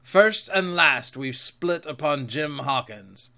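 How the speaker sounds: heated pirate captain's accusation; Silver, accusing pirate. Acted. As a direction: swear it like an old sea dog.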